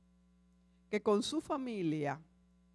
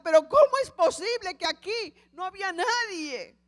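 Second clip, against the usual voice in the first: much higher, louder outraged shout; father shouting in grief and anger; 8 semitones higher, 6 dB louder than usual. Acted. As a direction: yell your outrage at the missing signs. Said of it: "empezó a gritar".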